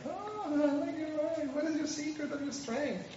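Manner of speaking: high, slow, soft wheedling woman's voice; coaxing Delilah. Retold or imitated. Delilah coaxing Samson for his secret, high wheedling voice. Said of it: "Delilah is like".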